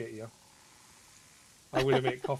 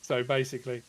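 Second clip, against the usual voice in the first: usual speaking voice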